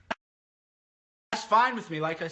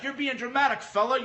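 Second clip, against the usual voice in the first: higher-pitched mock of a dismissive reply; mocking imitation; 7 semitones higher, 3 dB louder than usual. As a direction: quote the brush-off back, pitched up. Mimicking Joe's dismissive reply in argument.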